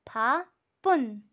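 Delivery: "भापुन" drawn out very slowly, each syllable apart; slow model word. Shown as a demonstration. Teacher sounding out a Korean word for students, very slow. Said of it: "means busy".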